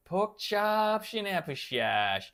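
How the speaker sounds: slurred, drawn-out old movie star impression; high start sliding down, slushy sibilants; Peter Brady's Humphrey Bogart. Streamer recalling a Brady Bunch line, Bogart impression. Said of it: "It's a Hum-, Humphrey Bogart impression".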